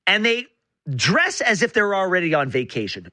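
ordinary delivery

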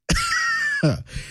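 short high burst of laughter; sudden high-pitched laugh. Real, not acted. Host amused by inattentive colleague.

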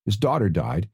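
ordinary talk, nothing marked

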